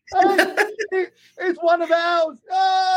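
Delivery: high-pitched, drawn-out mock cry of delight; excited acted announcement. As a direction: call the others over, thrilled and high. Man excitedly announces newfound brother.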